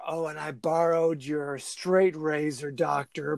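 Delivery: low, slow put-on voice for the killer's message; low, slow creepy killer voice; Bobby's voice. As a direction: read it like a creepy taunt. Voicing Bobby's message to doctor.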